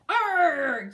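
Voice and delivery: loud pirate growl arr; hammed-up pirate cry. Greedy pirates in drawing.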